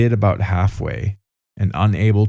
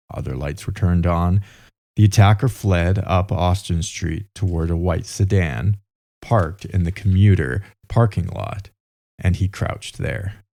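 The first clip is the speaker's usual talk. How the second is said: normal talking voice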